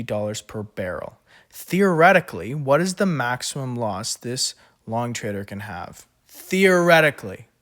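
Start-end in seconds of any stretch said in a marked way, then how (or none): 1.5-2.5 Theoretically said high and loud with a falling end; emphatic. Shown as a demonstration. Tutor stressing the word for students, emphatic. 6.3-7.5 Theoretically again high and loud, falling; emphatic. Shown as a demonstration. Tutor repeating the key word, emphatic.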